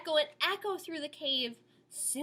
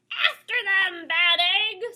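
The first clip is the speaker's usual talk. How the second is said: loud, high snarled command; snarling order; 8 semitones higher, 10 dB louder than usual. A narrator's reading. Mole ordering his bat, loud snarl. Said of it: "snarls Odious the Mole".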